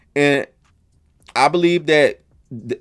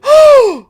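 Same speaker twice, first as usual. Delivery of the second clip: loud high jaw-drop gasp; big gasp; 9 dB louder than usual. Real, not acted. Host acting out hearing the result, loud gasp. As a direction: gasp as if slapped by the news. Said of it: "my jaw said".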